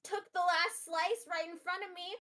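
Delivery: high, loud outraged yell; aggrieved shout. Acted. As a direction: holler the complaint across a room. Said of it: "yells, like, across the room".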